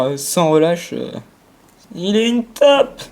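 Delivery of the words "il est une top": high, slow mocking voice. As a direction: tease him in a silly voice. Mocking a teammate.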